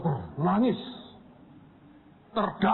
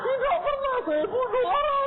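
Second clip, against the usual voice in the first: tearful denial. Retold or imitated.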